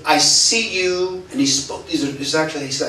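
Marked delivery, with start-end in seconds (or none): 0.0-1.2 drawn-out, higher preacher's pronouncement; slow prophetic pastor. Retold or imitated. Pastor calling Stephen up before the church, slow and raised. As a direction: lay it down slowly like a revelation.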